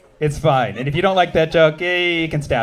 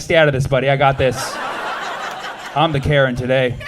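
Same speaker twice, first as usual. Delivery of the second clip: a hint of a bossy Karen, low and firm; lightly voiced Karen; 4 semitones lower than usual. Comic waving off the customer, mildly bossy. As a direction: shoo him off like a self-appointed Karen. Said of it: "I'm like".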